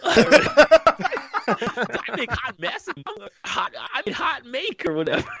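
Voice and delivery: high, slow laughing character voice; imitating the coach's odd speech, high and slow; his voice. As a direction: talk like the goofy coach while laughing. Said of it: "He talks like this".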